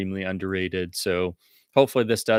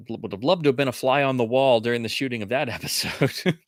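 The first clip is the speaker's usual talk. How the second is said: normal talking voice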